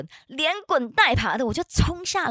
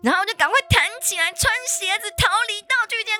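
panicked; 13 semitones higher, 4 dB louder than usual. Retold or imitated. Retelling frantic escape.

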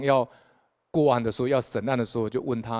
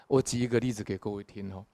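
ordinary delivery

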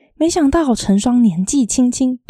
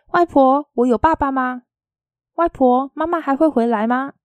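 higher, lightly voiced child asking Grandma; gently acted child's questions, pitched up; young grandchild; 5 semitones higher than usual. A narrator's reading. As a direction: ask Grandma as a small child would. Small grandchild asks grandmother questions.

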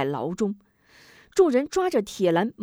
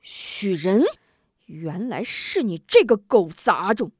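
louder, spitting out the insult; shocked fury; 4 dB louder than usual. Acted. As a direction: recognize the enemy and curse him. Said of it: "吴忠大惊".

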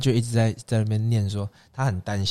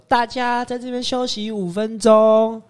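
put-on high, slow little-kid announcement; childish little voice; 10 semitones higher, slower than usual. Guide imitating the little girl announcing the break. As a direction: imitate a small child calling out to the group. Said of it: "他就用那个很稚嫩的声音说".

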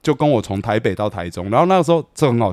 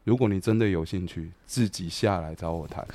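quiet veiled threat; 6 semitones lower, 12 dB quieter than usual. Retold or imitated. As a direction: let the last offer drop low and heavy. Ominous warning to Weiteng.

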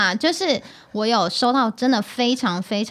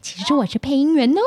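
talking doll; 2 semitones higher than usual. Acted. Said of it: "我真的好想要钻地洞哦".

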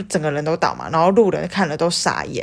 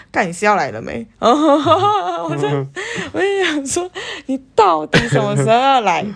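impatient exasperation; 10 semitones higher than usual. Retold or imitated. Impatient waiting for boyfriend.

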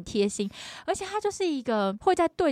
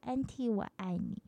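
a tender, softly spoken I love you; gently voicing the little boy; soft child's voice; 7 dB quieter than usual. The nephew says goodbye to his auntie, softly. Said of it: "Baby就看着我说".

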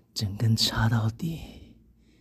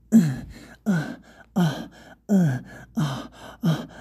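higher, louder short moans; acted moaning; 7 semitones higher, 5 dB louder than usual. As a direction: moan out in sharp bursts. Acted moans after narrated panting. (大声呻吟)

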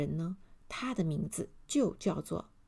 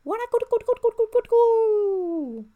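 very high, loud elf gibberish chant; magic incantation; 14 semitones higher, 11 dB louder than usual. A narrator's reading. Elf casts a spell on Mr. Arrogant, high chanting. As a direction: rattle off the spell, stretching the last sound. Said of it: "小声地念了".

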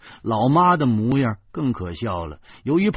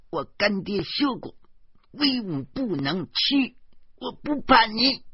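put-on high, slow, solemn childish declaration; a small child standing firm bravely; 大义凛然的小女孩; righteous little girl; 12 semitones higher than usual. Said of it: "大义凛然地说".

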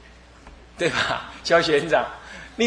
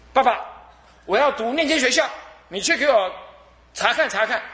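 higher, lightly voiced girl's request; demanding daughter; 6 semitones higher than usual. Daughter to her father, lightly voiced demand. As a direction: play the spoiled daughter giving orders.

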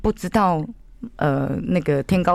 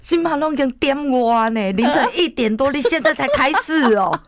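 shocked mama-san; 9 semitones higher than usual. Retold or imitated. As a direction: play the stunned bar hostess, up high. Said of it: "她就说".

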